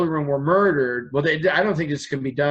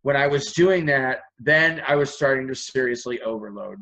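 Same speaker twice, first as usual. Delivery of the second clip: ordinary talk, nothing marked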